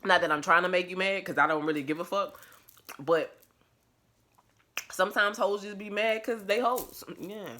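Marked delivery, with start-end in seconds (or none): none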